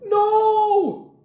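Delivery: very high, loud, drawn-out wail; anguished cry. A narrator's reading. Jack seeing Grandpa knocked out, horrified cry. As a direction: cry it out long in horror. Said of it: "cried Jack".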